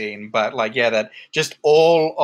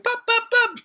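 put-on very high, playful little-kid voice; squeaky child playing along; Georgie. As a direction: chirp it out like a little boy. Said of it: "And then Georgie's like".

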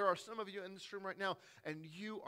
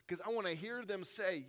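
normal talking voice

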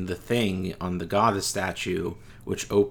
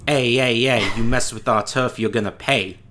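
put-on loud Brooklyn tough-guy accent; Brooklyn wise guy accent; 2 semitones higher, 5 dB louder than usual. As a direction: Brooklyn wiseguy threatening his turf. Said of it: "That's a pretty good accent".

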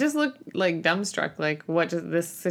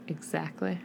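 deadpan quiet; 4 semitones lower, 11 dB quieter than usual. Retold or imitated. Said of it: "And I'm like".

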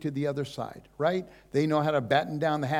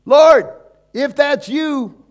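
Peter calling out; 11 semitones higher, 12 dB louder than usual. Acted. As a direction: shout out to Jesus over the waves. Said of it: "he says".

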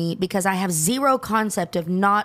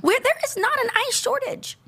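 exasperated; 14 semitones higher than usual. Real, not acted. Mocking the excuse.

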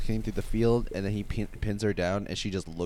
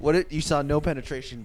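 ordinary delivery

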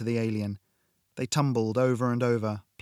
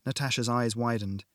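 plain reading voice

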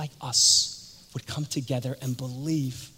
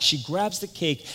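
usual speaking voice

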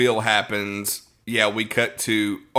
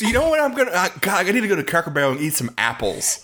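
mock customer voice; 6 semitones higher, faster than usual. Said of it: "Everybody thinks".

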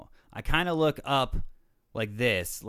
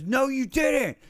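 much higher, louder retold yell; aggressive yell; 13 semitones higher, 7 dB louder, slower than usual. Big stranger escalating the argument at the store, imitating his angry yell. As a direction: snap it back as a hot, aggressive yell. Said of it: "he immediately got aggressive and yelled".

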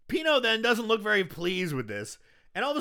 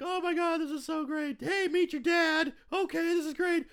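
much higher, gushing mock joy; overjoyed heroes; 12 semitones higher than usual. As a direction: play it giddy with relief. Heroes hugging, voiced in recap.

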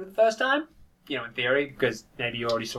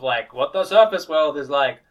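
ordinary talk

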